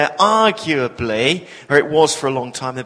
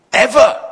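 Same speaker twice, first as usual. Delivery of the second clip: ever punched high and loud; stress on ever; 7 semitones higher, 8 dB louder than usual. Real, not acted. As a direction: hit the word ever hard. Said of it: "If you ever get on a bicycle".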